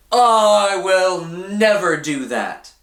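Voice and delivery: high, slow, emphatic protest; Peter insisting. A narrator's reading. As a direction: Peter swears he never would, drawn out. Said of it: "Peter said".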